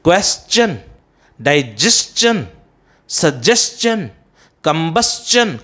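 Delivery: क्वेश्चन, डाइजेशन, सजेशन, कंबस्चन all ending "s-chun"; correct pronunciation. Shown as a demonstration. Teacher drilling the rule's examples, correctly pronounced. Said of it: "the correct pronunciation".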